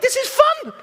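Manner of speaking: high-pitched delighted client voice; gleeful client. Retold or imitated. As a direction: sound like a kid having fun. Client enjoying the questions.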